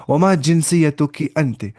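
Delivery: ordinary delivery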